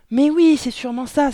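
high, sudden cry of realisation; loud exclamation. A narrator's reading. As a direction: exclaim it at full voice. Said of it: "s'exclama la mère". (exclamation aiguë et forte)

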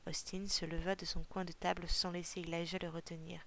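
ordinary delivery, nothing marked

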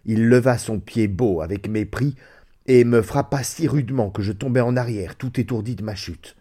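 ordinary delivery

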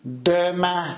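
bitter dying groan. A narrator's reading. Dying old man echoing the promise, bitter groan. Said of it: "râla le vieillard avec une immense amertume".

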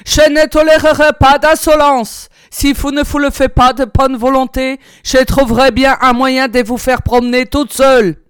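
raging. A narrator's reading. Enraged officer threatens the silent woman, furious shout. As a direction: thunder the threat at her. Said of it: "Il rageait".

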